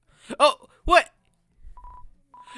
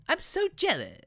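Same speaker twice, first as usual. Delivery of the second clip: high, exaggerated cartoon voice; wrong character voice; 12 semitones higher than usual. Kid monster envying the listener, in a high cartoon voice. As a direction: slip into a high-pitched theatrical character. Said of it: "please stop using Paris accent".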